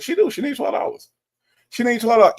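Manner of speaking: high, then loud, repeated punchline; comic insistence. Real, not acted. Streamer riffing on the title to chat, loud comic insistence. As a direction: sell the joke hard.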